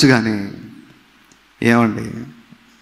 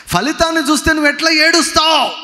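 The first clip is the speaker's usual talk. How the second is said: preaching shout; 11 semitones higher than usual. Real, not acted. Preacher challenges the congregation with a high shouted question.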